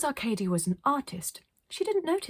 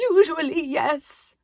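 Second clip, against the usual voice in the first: higher, louder proclaim; grand artist; 8 semitones higher, 8 dB louder, slower than usual. Acted. Arcadia answers Iris, higher and louder. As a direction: announce it.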